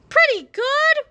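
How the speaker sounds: put-on high whiny old-man echo; whining old man. A narrator's reading. Old Harold whines the same words again, high whiny voice. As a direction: whine it like a grumpy grandpa. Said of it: "whined old Harold".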